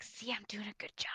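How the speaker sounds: hushed aside to his partner; stage whisper. Acted. Clerk whispering to Artie about doing a good job, hushed. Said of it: "Artie being like".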